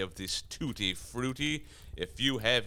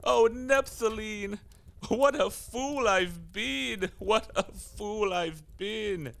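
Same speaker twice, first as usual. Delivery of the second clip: rising, drawn-out lament, each cry higher and louder; anguished wail; 11 semitones higher, 4 dB louder, slower than usual. Acted. Devil to himself, realizing he was fooled, wailing slow lament. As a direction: wail slowly as the realization dawns.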